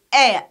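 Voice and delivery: "aya" loud, AY gliding to short schwa; triphthong pronunciation. Shown as a demonstration. Teacher showing the first one, "aya" with short schwa. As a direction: punch AY in "aya", drop to a weak schwa. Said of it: "You see here?".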